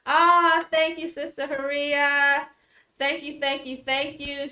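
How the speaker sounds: high, loud burst of gratitude; excited thanks. Real, not acted. Host thanking Sister Haria in the chat, loud and high. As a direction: gush thanks at the friends in chat.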